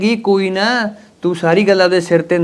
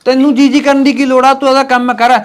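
angry scolding; 8 semitones higher, 4 dB louder than usual. Acted. Husband scolding wife in quarrel.

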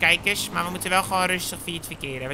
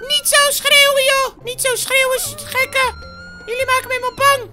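high, loud squawked protest; frightened scolding shout; 6 dB louder than usual. Acted. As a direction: yell shrilly for them to stop. Shouts at others to stop shouting.

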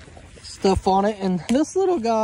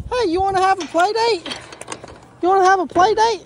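excited puppy talk; 7 semitones higher, 4 dB louder than usual. Real, not acted.

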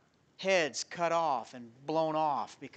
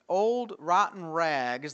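drawn out; 5 dB louder, much slower than usual. Real, not acted. Dwells on the rags.

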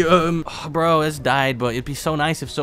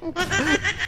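high giggle; short high-pitched chuckle; laugh. Real, not acted. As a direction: snicker at the annoyed teammate. Laughs at angry teammate.

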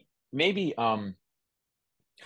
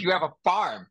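imitating Carson; 5 semitones higher, 3 dB louder than usual. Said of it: "all he said is".

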